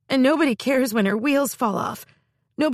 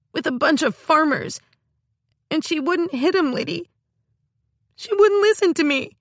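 crying rant; 6 semitones higher than usual. Acted. Girl rants to her sister through tears. Mim crying tantrum continues.

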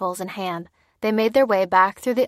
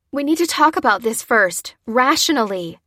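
raised, louder appeal; urgent pleading; 4 semitones higher, 6 dB louder than usual. A narrator's reading. Lucy stalling for time, pleading. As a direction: reason with him urgently.

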